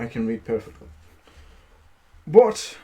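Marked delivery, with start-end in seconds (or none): 2.2-2.8 sharp, loud, high cry of terror; terrified cry. Acted. Timid giant cries out at the boastful tailor in terror. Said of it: "cried the timid giant in great terror".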